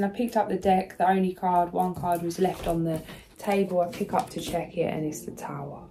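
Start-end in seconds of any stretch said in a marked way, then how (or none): none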